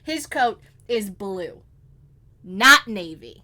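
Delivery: slow "blue", then "navy" hit loud; emphatic correction. Pretend. Correcting co-host on the coat, emphatic stress on BLUE and NAVY. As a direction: weigh out "blue" and punch "not navy".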